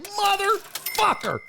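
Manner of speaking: high, drawn-out mock outrage; mock pissed off. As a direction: stretch the curse high, imitating someone pissed. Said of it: "Steve-O's pissed".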